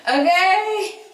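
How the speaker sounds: high, loud delighted okay; excited. Real, not acted. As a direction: squeal it with joy. Fire catches.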